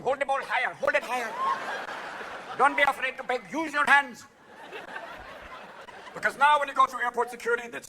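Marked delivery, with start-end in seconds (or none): 0.0-4.3 put-on high-pitched Indian accent; comic Indian-accented urging; mock Indian accent.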